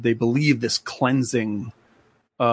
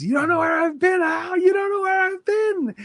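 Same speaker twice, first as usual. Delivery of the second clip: much higher, shouted manic cackle-voice; manic Brad Pitt. Retold or imitated. Retelling Fight Club, shouting Brad Pitt's taunt at the mobster. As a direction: shriek it like a bloodied madman laughing.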